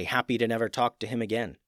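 ordinary delivery

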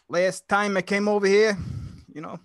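Jersey accent. Shown as a demonstration. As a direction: put on a Jersey street accent. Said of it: "Jersey accent and New York".

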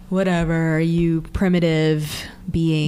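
slow, low, unbothered mock villain; lightly voiced disdainful monster; dismissive villain. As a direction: brush them off slowly and coolly. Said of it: "Santana's just, like, ignoring this".

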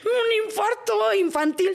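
high, slow, hammed-up alarm; mock alarm. Recalling her numb arm to the host, with mock alarm. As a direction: play up the panic. Retells thinking she had heart attack.

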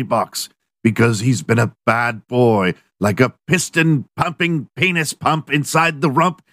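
high, grand mock-medieval recital; Old English style. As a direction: keep declaiming like a herald. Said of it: "fourteenth century Old English style".

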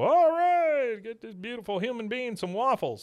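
high, loud cheering crowd voice; café crowd hailing Hank, high cheering; excited cheer. Retold or imitated. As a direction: whoop it up for the hero.

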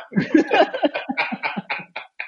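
long high-pitched laugh. Real, not acted. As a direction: crack up for a couple of seconds. Cracks up at own remark.

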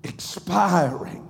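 rising, shouting preacher cadence; full-force sermon climax; preaching at full force. Acted. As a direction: preach it to the rafters, building.

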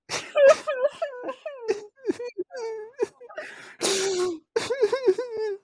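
long, very high squealing fake sobs; hammed-up sobbing. Podcast host overcome after naming his lost friends, hammed-up bawling. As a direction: bawl helplessly, playing it way over the top. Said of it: "some tears out of my eyes".

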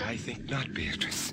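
soft, low hush; whispering. Acted. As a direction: keep it to a whisper. Whispering in dungeon.

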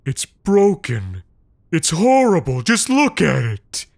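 disgusted outrage. Acted. Big man recoils from the deformed child, disgusted. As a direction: recoil in revulsion. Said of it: "wrinkled with disgust".

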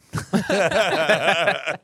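big burst of high laughter; loud laugh. Real, not acted. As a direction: crack up loudly. Bursts out laughing at own rating.

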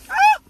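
a sudden high, loud ah; fervent cry. Real, not acted. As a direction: cry out as the spirit hits. Preacher crying out in fervor.

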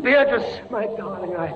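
high, pleading voice close to tears; anguished plea. Acted. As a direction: beg her with a breaking voice. Prisoner breaking down before wife.

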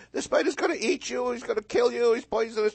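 put-on high, loud kid's taunt; a big brother scaring his little sibling, high and mocking. Big brother teasing a four-year-old with a spider, high taunting voice.

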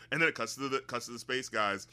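normal talking voice

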